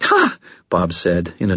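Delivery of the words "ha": very high forced laugh; nervous false laugh. Acted. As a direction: fake a laugh in fear. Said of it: "nervous, false laugh".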